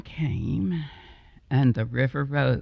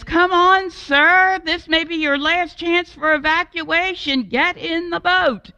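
high, loud urgent call over water; urgent shout; 13 semitones higher, 5 dB louder than usual. Acted. Boatman calling to the man at the window, urgent shout. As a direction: order him into the boat. Boatman urging last evacuation.

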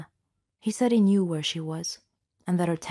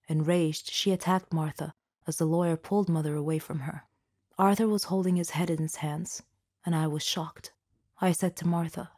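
ordinary delivery

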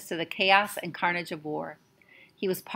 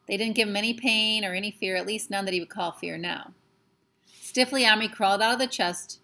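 ordinary delivery

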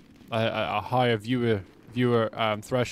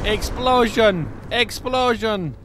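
drawn-out announcer call; 9 semitones higher, 5 dB louder, much slower than usual. Pretend. Announcing blast.

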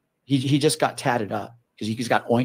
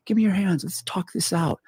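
higher, gentle coaxing to calm her; coaxing plea; 6 semitones higher than usual. Retold or imitated. Cop trying to calm the possessed woman, coaxing. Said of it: "I'm like".

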